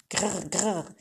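rolled grrr growl; acted animal growl. A narrator's reading. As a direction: growl twice like a small animal. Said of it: "begann zu knurren".

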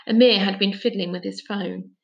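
plain reading voice

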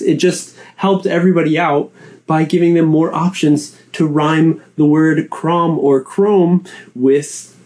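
usual speaking voice

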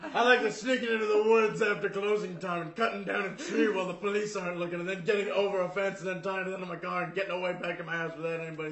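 loud, fast, high run-on boast; mock excited rant. Joking to co-host about secretly cutting a tree, mock excited rant. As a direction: rattle it off loudly in one breathless rush.

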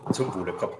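talking over a sip of hot tea; while sipping a drink. Real, not acted. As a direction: slurp and toast at once. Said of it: "du schlürfst mehr".

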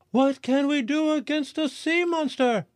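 very high florist voice; anxious florist. A narrator's reading. Florist asking the crowd, very high and anxious. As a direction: ask it high and worried. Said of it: "the florist asked".

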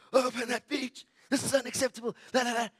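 high, loud imitation of a raging man; furious screaming. Angry beachgoer to lifeguards through the window, imitated furious shouting. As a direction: yell it like the fire of hell, mimicking him. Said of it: "like, the fire of hell".

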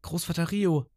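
hohe Mädchenstimme, rufend; ruft Großvater. Retold or imitated. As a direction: ruft ihren Großvater in der Höhle. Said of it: "schreit, äh, oder sagt". (hohe, rufende Mädchenstimme)

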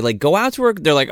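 normal talking voice

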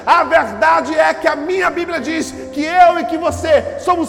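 loud, climbing preacher's cry; full-force preaching. Acted. Pastor to congregation, escalating loud preaching.

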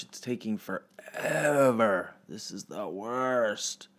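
slow, drawn-out grumble; bored boy grumbling over his chores; whiny complaint.

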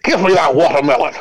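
hammed-up Southern boss demanding; mock Southern accent. Co-host as greedy Yakuza boss, mock Southern accent. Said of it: "that's the accent they get".

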